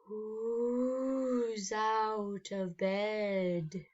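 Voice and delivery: very slow, eerie creeping question; icy ghoul voice. Acted. Vladimir luring Emma, icy and slow. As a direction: draw each word out coldly. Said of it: "an icy voice".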